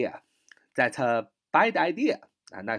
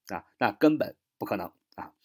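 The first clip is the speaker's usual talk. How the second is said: ordinary talk, nothing marked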